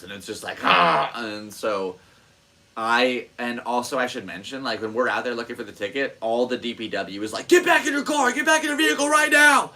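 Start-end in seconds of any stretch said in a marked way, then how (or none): 0.6-1.1 panicked yelp. Retold or imitated. Realizing the ticket is gone. 7.5-9.7 high, loud barked order; hammed-up official shouting orders; staff yelling. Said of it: "they're yelling at us".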